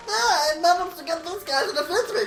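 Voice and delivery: high squeaky anime villain; high cartoon villain voice. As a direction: pitch way up like a cartoon baddie. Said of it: "And he's like".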